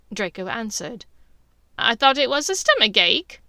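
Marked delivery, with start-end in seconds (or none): 1.8-3.4 snide. Acted. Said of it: "Bellatrix asked snidely".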